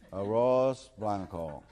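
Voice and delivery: put-on drawn-out American-accented Spanish. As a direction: repeat it laboriously with American vowels. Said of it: "George tiene que decir".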